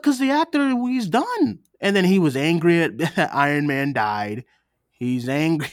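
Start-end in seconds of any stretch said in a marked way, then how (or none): 0.0-1.6 high, insistent explanation; emphatic explaining. Retold or imitated. Explaining to her boss why he left, high and insistent. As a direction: drive the point home. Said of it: "I'm like".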